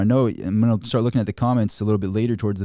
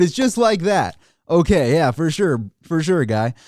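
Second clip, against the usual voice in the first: higher, louder mock "for sure"; sarcastic agreement; 6 semitones higher, 3 dB louder than usual. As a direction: agree while clearly mocking them. Sarcastic reply to wage advice.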